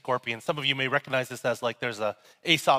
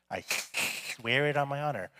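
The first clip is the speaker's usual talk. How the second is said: cartoon snake hiss; 4 dB quieter than usual. Snake swearing an oath to scorpion, hammed-up cartoon hiss. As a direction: overdo the snake's hissing s in "swear". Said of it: "I was watching cartoons last night".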